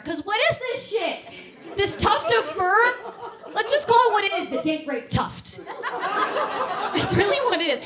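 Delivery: high, slow, exasperated comic rant; mock outraged rant. Comic tells ladies about soul patches, mock outrage. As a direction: draw it out in incredulous disgust.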